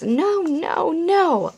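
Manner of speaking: sing-song scolding no; mock scolding for a toddler; playful no. Shown as a demonstration. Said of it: "You can also use those words".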